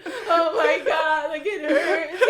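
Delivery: loud, high hammed-up wailing with laughter; imitated crying. Babysitter imitating a boy crying on the floor, wailing. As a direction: wail like a small boy in pain, exaggerated. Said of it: "he was crying".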